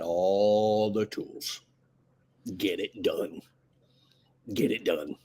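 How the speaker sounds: low, slow, drawn-out hinting; sly insinuation. Real, not acted. Coach advising a wife on persuading her husband, sly and low. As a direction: drop low and stretch it suggestively.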